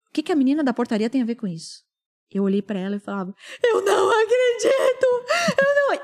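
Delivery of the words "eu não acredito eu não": high, strained mock wail of disbelief; retold desperate cry. Mother losing it in the reception, high retold cry. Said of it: "comecei a gritar na recepção".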